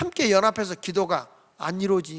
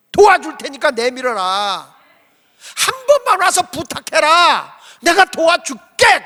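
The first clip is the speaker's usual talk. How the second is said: God pleading; 5 semitones higher, 7 dB louder, slower than usual. Retold or imitated. God voiced urging Moses.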